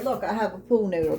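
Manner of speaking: ordinary talk, nothing marked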